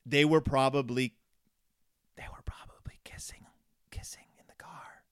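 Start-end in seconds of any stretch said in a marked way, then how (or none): 2.2-5.0 whispered like naughty gossip; mock-scandalized whisper. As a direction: drop to a hushed whisper.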